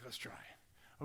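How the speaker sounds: slow hushed whisper. Retold or imitated. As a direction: whisper it like a secret plan. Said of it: "but I was like".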